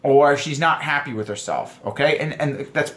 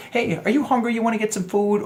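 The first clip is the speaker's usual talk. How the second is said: higher, sociable woman's voice; polite hinting woman; 7 semitones higher than usual. Retold or imitated. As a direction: float a friendly hint in raised pitch. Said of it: "keep everybody happy when they speak".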